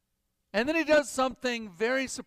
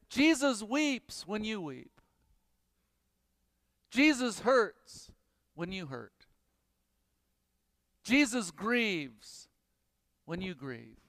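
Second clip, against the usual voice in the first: tender; 3 dB quieter than usual. Real, not acted. Tender closing sermon point.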